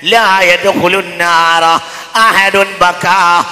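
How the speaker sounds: pleading, forceful sermon; impassioned Malayalam preaching; preaching at full force. Real, not acted. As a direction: urge the faithful to weep for God. Urgent appeal to believers.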